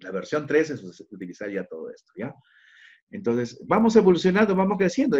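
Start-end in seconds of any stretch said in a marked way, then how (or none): 3.7-5.2 upbeat rally. Real, not acted. Teacher rallying the class.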